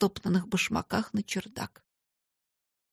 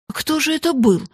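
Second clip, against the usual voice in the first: high, louder startled question; exclaiming; 8 semitones higher, 6 dB louder than usual. A narrator's reading. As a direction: exclaim it in alarmed curiosity. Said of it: "воскликнула Эрмингарда".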